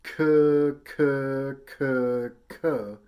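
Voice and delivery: very loud, held "K" syllables said as "kuh"; K-tongue syllables. Shown as a demonstration. Teacher readying the K tongue for trombone, loud "kuh" for each "K". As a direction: punch each "K" from the back of the mouth as "kuh". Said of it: "do the K tongue".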